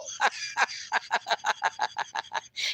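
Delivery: breathless, fading laughing fit; real laughter. Real, not acted. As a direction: laugh until the voice gives out. Laughing at Scott's story.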